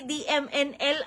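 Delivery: ordinary talk, nothing marked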